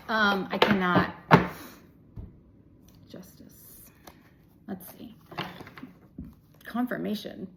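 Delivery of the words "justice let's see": unvoiced whisper of the card name. Real, not acted. Tarot reader naming a drawn card, whispered. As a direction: whisper the card as you draw it.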